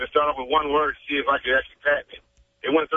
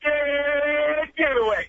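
very slow, much higher Dead Giveaway; drawn-out catchphrase; 10 semitones higher, much slower than usual. Shown as a demonstration. Guest drags out his catchphrase in a battle with the host. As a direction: stretch every syllable of Dead Giveaway up high. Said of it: "Let's have a battle".

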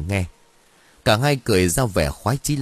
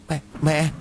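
startled cry; 7 semitones higher than usual. Acted. Seeing dead mother's shadow.

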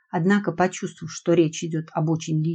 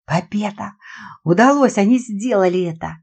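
triumphant cheer; 3 semitones higher, 5 dB louder than usual. Acted. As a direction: cheer the escape loudly.

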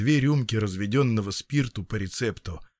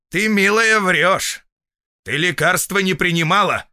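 loud, raised accusation; stern; 8 semitones higher, 6 dB louder than usual. A narrator's reading. Feldsher accusing patient of lying, stern. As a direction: confront the liar head-on. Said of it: "и строго молвил".

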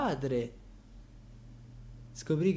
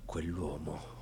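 hushed contempt; 9 semitones lower, 11 dB quieter, slower than usual. Acted. Contempt for the grandfather.

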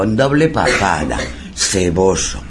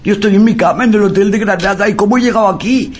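high, fast, bewildered panic; high-pitched, hurried alarm; mock frightened boy; 9 semitones higher, faster than usual. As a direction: imitate a scared boy protesting in a rush. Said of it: "tenía un miedo, estaba más asustado".

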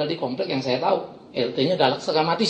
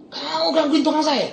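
retold scolding; 8 semitones higher than usual. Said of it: "Langsung saya bentak".

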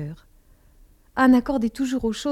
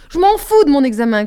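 loud, higher, defiant shout; 5 semitones higher, 10 dB louder than usual. Acted. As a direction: yell it in teenage revolt. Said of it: "criai-je".

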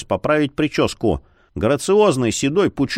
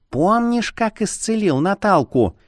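high, tiny wifely squeak; thin, high-pitched question; 9 semitones higher than usual. A narrator's reading. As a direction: pipe up in a little mosquito voice. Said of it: "пискнула супружница".

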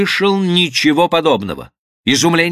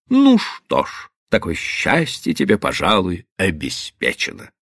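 mocking; 7 semitones lower than usual. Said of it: "говорил с издёвкой".

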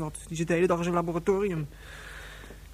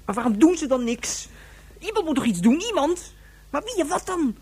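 rising, louder, desperate cry; anguished outcry; 10 semitones higher, 5 dB louder than usual. Acted. Girl alone in the cave crying out in despair. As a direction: plead to no one, higher and higher.

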